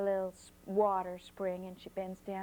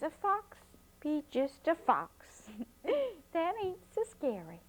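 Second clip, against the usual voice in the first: put-on girl echoing grandma; 6 semitones higher than usual. A narrator's reading. Flossie repeats grandma's saying.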